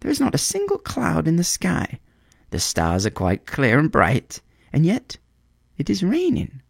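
a bird baffled by rain from a clear sky; puzzled swallow. A narrator's reading.

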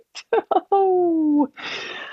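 gleeful laugh. Real, not acted. Narrator chuckles before twist.

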